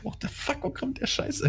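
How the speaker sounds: mock confused. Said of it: "du denkst".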